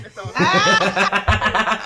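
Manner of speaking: words dissolving into a big laugh; breaking into laughter. Real, not acted. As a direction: finish the speech cracking up. Breaks up laughing after his speech.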